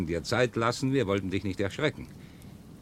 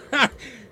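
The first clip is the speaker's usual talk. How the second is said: Lachen. Acted. Lacht über die Fernsehjagd.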